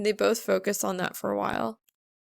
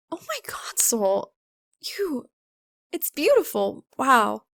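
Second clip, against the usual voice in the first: high, surprised gasp then praise; be floored by his talent; delighted astonishment; amazed; 4 semitones higher than usual. A narrator's reading. Amazed he wrote it.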